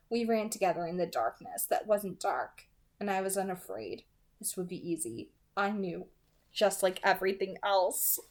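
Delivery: hushed, intimate audiobook reading; soft story narration. A narrator's reading. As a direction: read it softly, drawing the listener in. Reading novel narration aloud.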